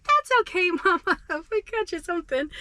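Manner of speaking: put-on high, sweet kid voice; lightly voiced children consoling mama; imitating kids' voice. Kids reassure her, voiced high.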